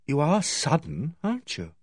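slow, soft and wondering; awed. A narrator's reading. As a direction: marvel at him quietly. Megan awed by his impulse.

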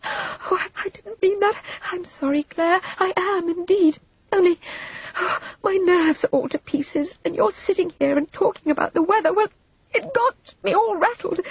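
high, rattled apology; flustered, high-pitched pleading; hasty recanting. A narrator's reading. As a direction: backpedal breathlessly with nerves in pieces. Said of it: "Vivian hastily recanted".

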